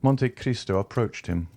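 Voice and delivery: usual speaking voice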